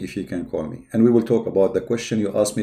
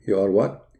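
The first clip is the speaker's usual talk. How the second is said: ordinary delivery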